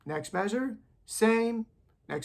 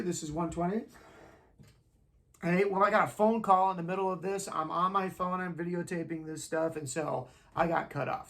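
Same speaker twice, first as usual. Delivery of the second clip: ordinary talk, nothing marked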